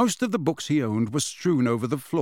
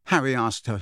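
plain reading voice

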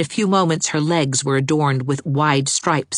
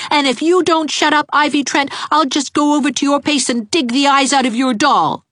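put-on high, louder child threat; a boy bullying a girl; high, louder boy's threat; threatening boy; 12 semitones higher, 5 dB louder than usual. Gerald threatens Ivy.

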